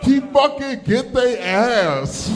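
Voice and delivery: loud, high, drawn-out holler; rowdy egging on. Acted. Comedian echoing a supportive audience member, rowdy holler. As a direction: holler it slowly like a hyped-up heckler.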